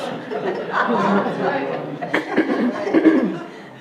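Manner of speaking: a long, easy laugh after the joke; amused laughter. Real, not acted. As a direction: let the laugh roll out.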